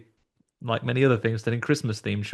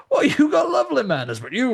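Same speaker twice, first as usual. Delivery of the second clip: Southern skeleton scolding rudely, much higher; southern skeleton accent; 13 semitones higher, 4 dB louder than usual. Retold or imitated. Skeleton actor scolding Andy in a Southern accent, high and loud. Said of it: "very southern skeleton".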